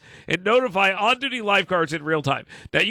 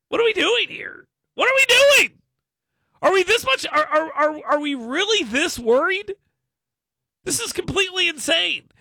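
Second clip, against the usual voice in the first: incredulous rant; 9 semitones higher than usual. Real, not acted. Host ranting at parents worried about pool cameras, incredulous. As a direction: sound like you can't believe it.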